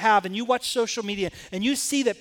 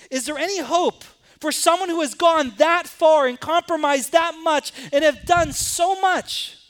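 raised, higher, louder preaching build; impassioned preaching; 5 semitones higher, 4 dB louder than usual. Acted. Preacher asking the church about hope, fervent. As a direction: press the question on the congregation with rising fervor.

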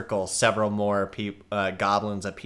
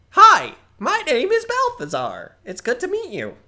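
much higher, louder eager greeting; cheerful young goblin; 10 dB louder than usual. Acted. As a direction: beam it out like a keen goblin kid. Balthazar introduces himself.

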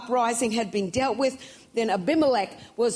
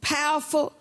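full-force preaching; 5 semitones higher than usual. Acted. Preacher to church about the certain woman, fervent preaching. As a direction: lift the congregation, press every line.

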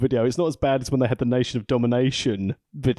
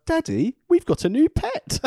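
put-on child voice. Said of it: "was like".

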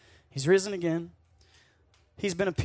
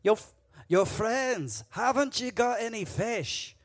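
calling out; 6 semitones higher, 5 dB louder, slower than usual. Acted. Jesus calls out from shore.

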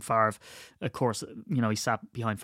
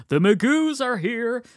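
high, slow proclamation; drawn-out, high hotel-lobby announcement; mock grand announcement; 11 semitones higher, 4 dB louder, slower than usual. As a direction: imitate a guest announcing an arrival, pitched way up. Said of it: "come into the hotel and went".

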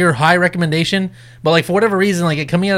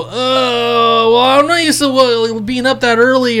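higher whiny mock voice of a friend; high put-on whine mocking his excuse; mocking Armando; 6 semitones higher, slower than usual. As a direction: whine it back like the lazy friend. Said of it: "I hear Armando go".

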